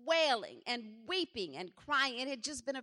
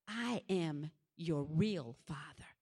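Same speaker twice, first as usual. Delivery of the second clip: slow, low fatherly declaration; solemn voice of God; 7 semitones lower, 4 dB quieter, slower than usual. Retold or imitated. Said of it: "He said".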